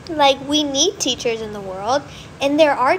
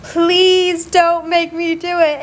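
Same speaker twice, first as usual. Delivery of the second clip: slow, higher and louder mock whine; begging the teacher a third time, more desperate; desperate pleading; 4 semitones higher, 6 dB louder, slower than usual. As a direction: beg harder, drawing each word out. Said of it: "And I was like".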